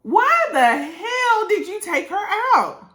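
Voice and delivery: high, loud, slow indignant demand; furious why the hell; outraged. Real, not acted. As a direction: confront him like he owes you an answer. Outraged at the man's behaviour.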